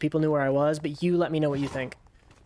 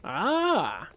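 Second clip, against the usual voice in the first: short startled cry, pitched up; startled yelp; 3 semitones higher than usual. Real, not acted. Gamer startled by an in-game alien, short yelp. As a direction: jump at the sudden fright.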